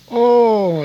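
a high, loud burst of delight; excited oh. Retold or imitated. Old friend remembering boyhood mischief, excited. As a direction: exclaim with sudden glee.

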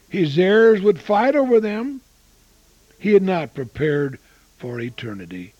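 ordinary talk

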